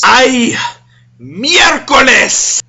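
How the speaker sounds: loud, high, drawn-out "Ay, miércoles!"; exasperated Spanish shout, slowed and stretched; angry shout. Retold or imitated. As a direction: blurt it out like a furious mom at the mess. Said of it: "My mom's anger disappears".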